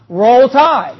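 loud "Roll Tide"; cheering for Alabama; fan cheer. Shown as a demonstration. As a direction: call it out like a fan. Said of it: "you say what".

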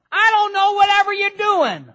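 high, loud mock nag; hammed-up exasperation. Preacher playing a clueless nag, hammed-up and high. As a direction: throw up your hands, mock exasperated.

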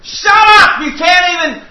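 high, loud mock yell of Shut up. Preacher yelling at a man singing on the drive, loud and high. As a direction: bark it at the singer, high and loud. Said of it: "I yelled at the guy singing".

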